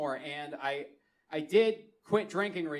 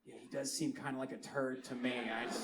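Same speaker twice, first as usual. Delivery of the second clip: low, soft aside to a friend; imitated uneasy bystander; wary aside; 6 semitones lower, 11 dB quieter than usual. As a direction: confide it quietly while backing away. Stranger backing away, aside to friend.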